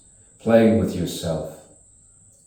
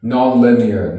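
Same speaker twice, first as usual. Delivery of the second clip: "Non-linear" lifted louder, then falling; emphatic first take; 3 semitones higher, 6 dB louder than usual. Acted. Poet musing on the mind, emphatic "Non-linear". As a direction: throw out "Non-linear" strongly, voice raised. Word repeated in contrasting delivery.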